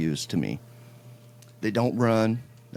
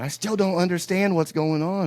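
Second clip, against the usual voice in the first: imitating a confused wife, much higher; high-pitched mock wife, not following; bewildered wife; 9 semitones higher than usual. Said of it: "my wife's like".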